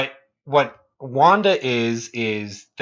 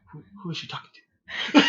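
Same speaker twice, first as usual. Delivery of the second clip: hushed aside to the wife; mock-confused murmur, halting; voiced baffled dad; 4 semitones higher, 5 dB quieter, much faster than usual. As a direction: ask very softly, acting bewildered.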